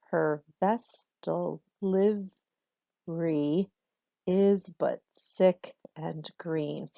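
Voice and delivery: very slow, syllable by syllable; slow metrical verse reading; scanning the verse. Shown as a demonstration. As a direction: lay out each syllable of the line slowly. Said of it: "So I did that again".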